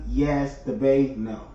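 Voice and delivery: ordinary talk, nothing marked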